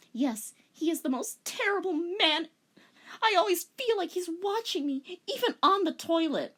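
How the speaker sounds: high, melodramatic complaint; dramatic girl. Acted. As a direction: whine it dramatically. Rei ranting about father.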